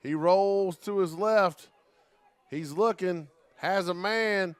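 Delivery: real sportscaster excitement; excited play-by-play. Real, not acted. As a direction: call the live play with rising suspense. Live play-by-play as play builds.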